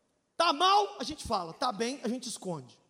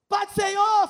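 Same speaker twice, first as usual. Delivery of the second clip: grito assustado; 8 semitones higher, 12 dB louder, slower than usual. Retold or imitated. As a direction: shout "Pai do Senhor!" high and loud. Irmão gritando na tempestade. (grito de susto, alto e agudo)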